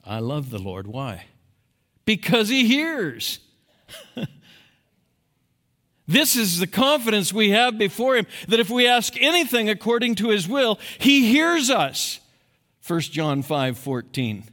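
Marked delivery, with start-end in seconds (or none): 2.1-12.2 high, loud emphatic preaching; full-force sermon. Acted. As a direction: drive it home from the pulpit.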